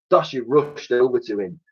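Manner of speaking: ordinary delivery, nothing marked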